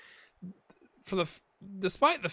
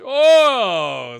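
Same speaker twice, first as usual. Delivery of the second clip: loud, high, drawn-out "oh"; real cry of surprise at the play; excited shout; 10 semitones higher, 17 dB louder than usual. Real, not acted. Streamer reacting to the match, loud drawn-out shout.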